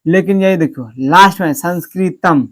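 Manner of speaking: ordinary delivery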